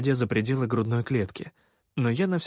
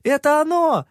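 put-on high, loud childlike yell; excited yell; 7 dB louder than usual. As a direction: yell it out, thrilled with the find. Kid yelling about the find.

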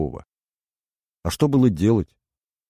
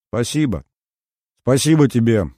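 звонко; 6 semitones higher, 6 dB louder than usual. A narrator's reading. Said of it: "Хрустальным колокольчиком долетело".

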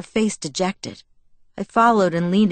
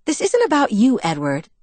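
higher-pitched retort that drops on the name; shot back; 5 semitones higher, 3 dB louder than usual. A narrator's reading. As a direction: fire back a quick objection. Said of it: "she shot back".

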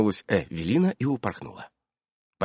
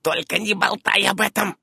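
high, grumpy warning; peevish, raised-pitch order; cantankerous; 11 semitones higher than usual. A narrator's reading. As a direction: scold mildly as an old witch. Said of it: "сварливо заметила она".

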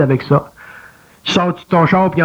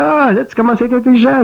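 a bit higher and quick, lightly patronizing; half-praise with a teasing edge; mocking little voice; 5 semitones higher, faster than usual. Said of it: "tu vas dire".

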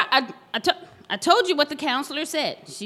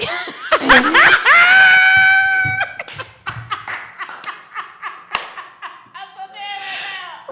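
loud, high, helpless laughing shriek; real screaming laughter; hysterical laugh; 13 semitones higher, 8 dB louder than usual. Real, not acted. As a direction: laugh until you can't breathe. Hysterical laughter after lotion joke.

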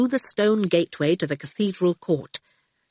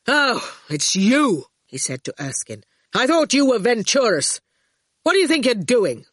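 higher, irritated challenge; annoyed confrontation; 4 semitones higher than usual. A narrator's reading. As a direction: demand an explanation, crossly. Archer angrily confronts the digger driver.

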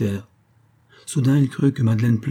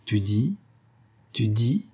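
stunned repetition; 2 semitones higher, slower than usual. A narrator's reading. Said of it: "trois fois de suite répéta".

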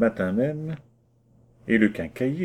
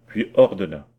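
ordinary delivery, nothing marked

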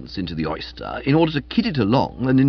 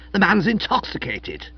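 petulant; 8 semitones higher than usual. Acted. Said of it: "petulant waggle of the bean".

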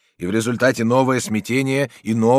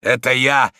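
loud, raised call; shouted reply; 4 semitones higher, 7 dB louder than usual. A narrator's reading. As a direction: call up from below. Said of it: "закричал снизу верховный судья". (крикнуть снизу)

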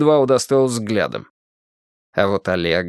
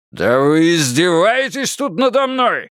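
thundering anger; 7 semitones higher, slower than usual. Acted. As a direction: roar in outrage. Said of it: "загрохотал гендиректор".

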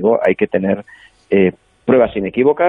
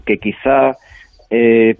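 ordinary talk, nothing marked

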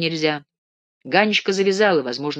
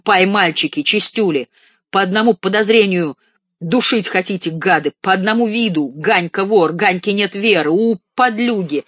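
louder and higher throughout, mock fury; furious tirade; 5 semitones higher, 6 dB louder than usual. Ganechka ranting at roommates who suspect him, loud. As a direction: rant at them, voice raised.

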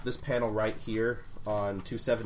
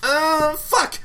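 high loud shocked cry; melodramatic dismay; 7 dB louder than usual. Retold or imitated. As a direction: cry out like a tragic heroine. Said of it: "the transition from".

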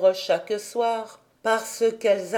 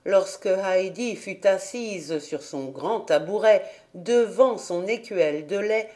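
usual speaking voice

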